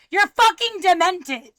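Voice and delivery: loud, high, slow condemnation; angry accusation. Real, not acted. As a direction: throw it out forcefully, drawing it out.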